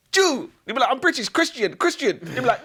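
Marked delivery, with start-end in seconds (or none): 0.1-0.5 high mock shout; hammed-up accusing yell; insisting interrogator. 0.9-2.2 high-pitched acted plea; hammed-up high protest; pleading prisoner.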